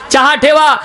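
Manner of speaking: high-pitched call to the kitchen; acted householder hollering. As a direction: call the order through the house. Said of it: "यांनी आवाज दिला".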